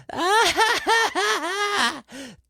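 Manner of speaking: sudden high squealing laugh mid-song; high burst of laughter. Real, not acted. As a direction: break into a high giggle.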